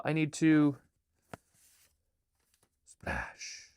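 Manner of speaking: lively storybook reading with voices; reading her picture book aloud; reading to children. Acted. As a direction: read it like bedtime to kids.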